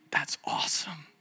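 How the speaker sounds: an awed whisper; hushed marvelling aside. Real, not acted. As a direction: breathe it out, no voice. Awed aside after the story.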